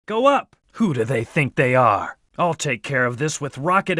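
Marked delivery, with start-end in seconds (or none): 0.0-0.5 high, sudden command; urgent command. Acted. Game hero directs teammates under fire, urgent command. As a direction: call out a quick dodge order.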